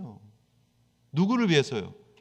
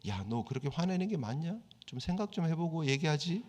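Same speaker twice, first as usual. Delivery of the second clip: softer, calm fatherly rebuke; God gently questioning; 8 dB quieter than usual. Retold or imitated. God quietly asks angry Jonah to think, gentle. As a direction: ask it gently, like a patient father. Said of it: "요나한테 질문하세요".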